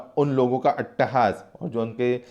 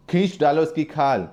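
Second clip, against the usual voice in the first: cruel order; 5 semitones higher than usual. Acted.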